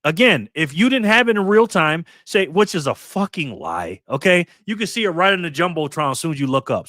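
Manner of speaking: usual speaking voice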